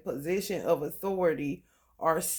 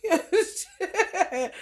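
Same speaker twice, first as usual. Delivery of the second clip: slow, high, said through a laugh; 12 semitones higher, 4 dB louder, slower than usual. Real, not acted. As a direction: let the laugh carry the words. Laughing after the mock quote.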